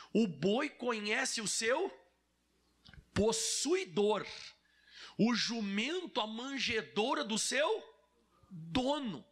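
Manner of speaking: slow, deliberate verse with long pauses; drawn-out, weighty reading; emphatic scripture reading. Acted. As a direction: proclaim the verse, holding back the key word.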